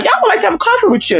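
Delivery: very high, fast prim lady; old lady voice. Retold or imitated. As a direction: mimic the eager elderly lady.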